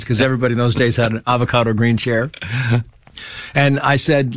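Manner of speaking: ordinary delivery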